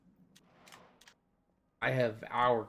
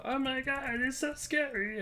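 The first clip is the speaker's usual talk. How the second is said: very high mock-scared squeal; hammed-up falsetto fright; scared player. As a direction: squeak it out like a spooked newbie. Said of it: "they go".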